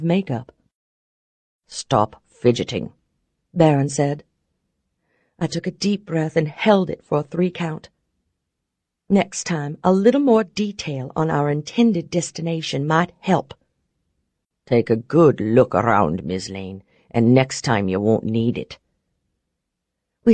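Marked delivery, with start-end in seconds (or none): 1.7-2.9 low, slow, curt command; hint of a cold mentor; stern low man voice. Barrons speaks, low slow. 14.7-18.8 deep, cool and curt; low male voice. Acted. Barrons lectures Mac.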